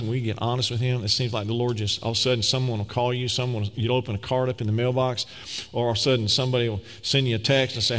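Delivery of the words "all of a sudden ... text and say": ordinary delivery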